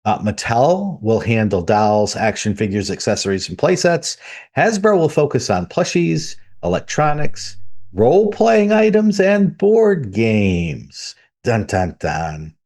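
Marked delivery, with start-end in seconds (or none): 3.5-9.2 mock-dramatic build toward a reveal; building hype. Real, not acted. Hyping up toy product list. 9.2-11.1 drawn out very slowly; slow reveal. Real, not acted. Punchline of the hyped list. 11.4-12.6 sung dun dun dun, falling and fading; dropping lower and softer on the last dun; dramatic sting. Pretend. Mock dramatic sting after news.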